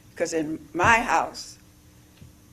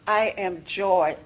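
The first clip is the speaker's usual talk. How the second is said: ordinary delivery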